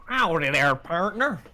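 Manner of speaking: put-on Russian character forcing a Southern American accent; cowboy American accent. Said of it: "Russian Rhino trying to be an American".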